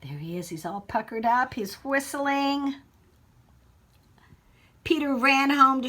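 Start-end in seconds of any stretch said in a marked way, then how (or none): none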